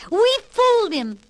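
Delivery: high, loud triumphant piggy voice; gleeful pigs. Acted. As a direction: crow over the wolf, high. Pigs gloating after tricking wolf.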